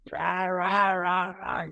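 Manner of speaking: high, mock baby wail "ra, ra"; imitated baby crying. Mother imitating her newborn daughter's crying, high mock wail. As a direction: mimic a newborn crying nonstop.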